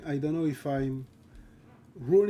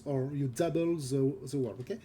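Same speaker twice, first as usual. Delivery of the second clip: ordinary talk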